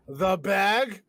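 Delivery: slow booming Bag of Doom; dramatic announcer. Pretend. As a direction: announce it like a game show.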